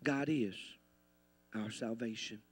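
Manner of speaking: very soft, low near-murmur; hushed repeat. Real, not acted. Preacher repeats salvation to the church, hushed and low. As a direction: let it sink in quietly. Same declaration repeated very softly.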